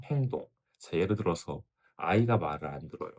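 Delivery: normal talking voice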